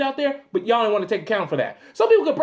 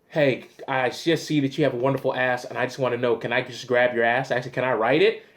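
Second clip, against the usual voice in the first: lower, softer leering come-on; low sleazy voice; 11 semitones lower, 3 dB quieter than usual. Retold or imitated. Prankster to a stranger, lower and softer leering come-on. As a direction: purr the line like a creep. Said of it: "be like".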